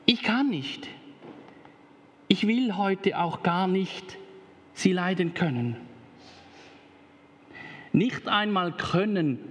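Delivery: lively storybook reading with voices; reading to children. Acted. Pastor reading a children's story to the congregation, lively. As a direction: read it like a bedtime story.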